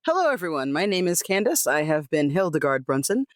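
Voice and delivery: ordinary talk, nothing marked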